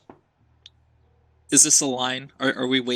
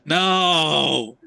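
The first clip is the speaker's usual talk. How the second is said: dismayed cry; 4 semitones higher, 4 dB louder than usual. Real, not acted. Ambush surprises player.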